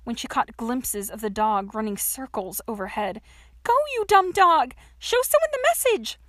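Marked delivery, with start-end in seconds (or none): 3.6-6.2 high, loud exasperated shouting; frustrated holler, pitched right up; irritated yell. A narrator's reading. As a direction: yell impatiently at a disobedient dog. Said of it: "Her excitement soon turned to irritation".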